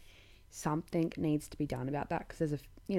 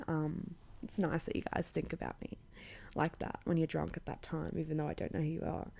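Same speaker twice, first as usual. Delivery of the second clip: soft, hushed whisper, half teasing; whispered aside; 6 dB quieter than usual. Real, not acted. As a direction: lean in and whisper it to listeners. Teasing aside to drunk callers.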